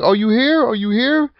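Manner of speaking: imitating the call, asking twice. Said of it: "he's like".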